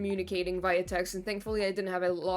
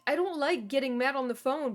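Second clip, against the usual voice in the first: ordinary talk, nothing marked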